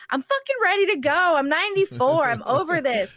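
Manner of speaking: high, loud imitation of an exasperated grandma; mock fed-up outburst. Grandma retold, complaining about still being alive, mock fed-up outburst. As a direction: push it up and out, comically indignant.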